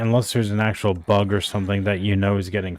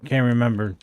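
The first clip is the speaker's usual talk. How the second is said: ordinary talk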